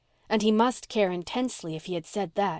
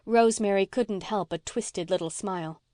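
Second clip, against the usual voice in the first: ordinary delivery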